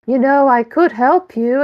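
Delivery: hunky Ken flexing at the detective; flirty Ken. Acted. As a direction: show off the muscles with it. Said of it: "gesturing towards my mustache".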